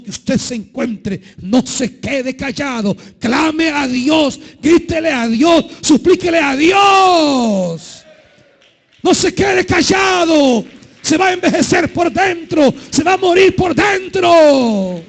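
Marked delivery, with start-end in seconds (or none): 1.4-3.0 firm pastoral urging, slightly raised; earnest sermon plea at moderate strength; earnest preaching. Acted. Same phrase repeated louder. 3.2-8.0 slow, higher, louder each call; fervent sermon exhortation, building; rising preacher's shout. Acted. 9.0-15.0 much higher and louder, shouted; fiery pastor shouting at the congregation; full preaching shout. Acted. Shouted repeat of the plea.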